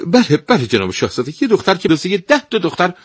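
high, fast and eager; excited stepmother. Acted. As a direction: gush out the answer in a rush. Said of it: "زن با هیجان جواب داد".

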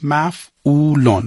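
slow, drawn-out dictation; dictation pace. Shown as a demonstration. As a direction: say it slowly for students to write. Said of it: "علامتاش رو زیرش بنویس".

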